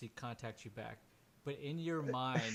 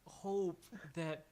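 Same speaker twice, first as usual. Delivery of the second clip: halting, higher-pitched disbelief; broken, exasperated man; 6 semitones higher, 8 dB louder, slower than usual. Acted. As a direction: break it into pieces, pitch climbing in despair. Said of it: "he's completely broken at this point".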